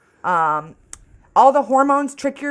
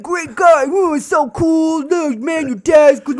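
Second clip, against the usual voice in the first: gushing admirers; 9 semitones higher, 4 dB louder, slower than usual. Retold or imitated. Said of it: "people are always like".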